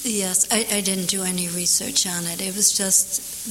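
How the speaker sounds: ordinary talk, nothing marked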